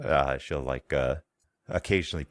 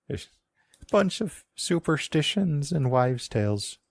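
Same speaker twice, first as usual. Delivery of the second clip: put-on high old woman with an Irish-Scottish twang; high, lilting Irish-Scottish granny; old woman accent; 11 semitones higher than usual. GM voicing the old woman with an Irish-Scottish twang.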